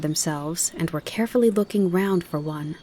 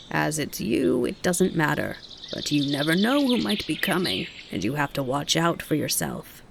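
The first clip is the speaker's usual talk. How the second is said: rasping. Acted. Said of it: "in his rasping voice".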